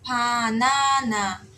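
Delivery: "panana" very slow, with P as in pato; syllable-by-syllable demonstration. Shown as a demonstration. Teacher introduces the Korean word for banana, slow demonstration. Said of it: "vai ser P de pato".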